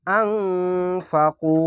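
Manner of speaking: আনফাকু read very slowly, final u held one alif; chanted Quranic reading; long u stretch. Shown as a demonstration. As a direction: draw out the last vowel of আনফাকু. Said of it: "এক আলিফ টেনে পড়বেন".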